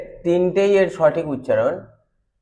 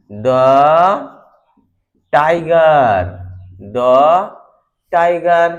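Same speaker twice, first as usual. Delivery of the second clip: louder, "the" as short "duh"; loud demo; 7 dB louder, much slower than usual. Shown as a demonstration. Teacher loudly demonstrating "the tiger". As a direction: call it out clearly. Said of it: "demo of দ before consonant".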